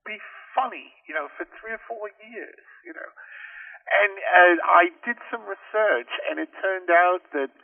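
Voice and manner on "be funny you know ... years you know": high-pitched, puzzled reaction; retold bafflement, starting high and trailing softer; puzzled surprise. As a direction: wonder aloud, pitched well up. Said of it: "And I thought".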